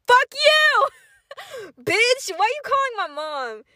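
high mock yell, easing off at the end; hammed-up outrage with a laugh in it; retold angry outburst. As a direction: lash out in a high, indignant voice. Acted anger at vice principal.

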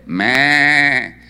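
loud imitated baa, held long; sheep bleat. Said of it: "¿qué se escuchaba?". (balido fuerte de oveja)

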